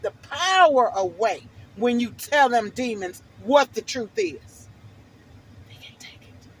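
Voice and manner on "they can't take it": hushed unvoiced whisper. Telling of demons in a sudden whisper. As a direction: breathe it out like a secret. Demons can't take the truth.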